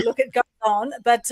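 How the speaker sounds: ordinary delivery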